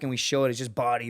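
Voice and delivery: normal talking voice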